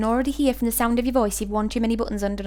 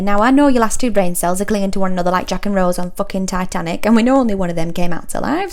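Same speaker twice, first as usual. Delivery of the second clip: normal talking voice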